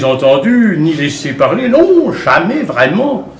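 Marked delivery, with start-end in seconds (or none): none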